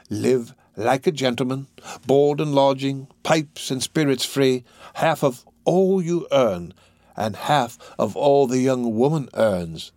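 slow, drawn-out enticing list. Acted. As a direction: tempt the recruit, savouring each item slowly.

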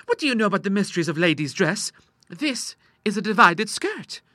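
put-on high-pitched, self-assured young lady; daughter correcting father. Daughter explaining her dress to papa, high and assured.

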